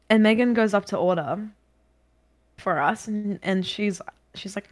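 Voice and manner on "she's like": ordinary talk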